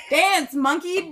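high, loud taunting chant; loud mock command. Ordering a monkey to dance, loud mock command.

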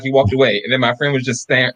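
ordinary talk, nothing marked

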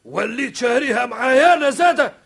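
high, loud indignant retort; indignant shout. Acted. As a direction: fire it back in outrage.

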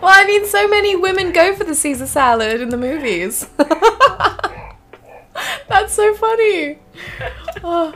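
high, laughing through the words; amused, high-pitched and laughing; real laughter. Real, not acted. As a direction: talk through helpless giggles. Laughing at the salad story.

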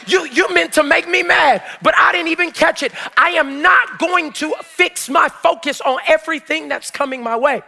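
impassioned preacher in full cry; full-force preaching. Real, not acted. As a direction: build to loud, driving phrases for the congregation.

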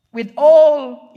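sudden loud shout on all; loud burst. Real, not acted. Preaching about Peter's bold sermon, sudden loud shout. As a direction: belt it out like a preacher at full force.

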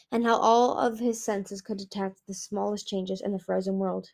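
ordinary delivery, nothing marked

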